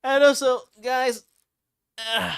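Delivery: very high, loud anime voice; high-pitched character voice. Real, not acted. Streamer addressing the guys, high-pitched character voice.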